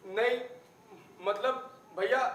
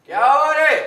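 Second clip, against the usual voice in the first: loud, higher-pitched demand; impatient shout; 4 semitones higher, 11 dB louder than usual. Acted. Man pressing a silent younger man for news, impatient shout. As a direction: raise the voice and demand an answer.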